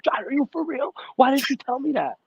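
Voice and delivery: very high-pitched mock disbelief; imitating the duped friend. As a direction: squeal it as an incredulous question. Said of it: "And he's like".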